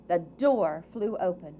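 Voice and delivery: ordinary delivery, nothing marked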